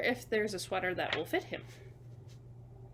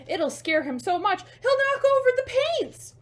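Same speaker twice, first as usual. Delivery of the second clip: rising higher and louder to the scare; building excitement; 11 semitones higher, 13 dB louder than usual. A narrator's reading. Reading the ghost scare to children, higher and louder. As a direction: build up, pitch climbing to a shriek. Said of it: "and shout".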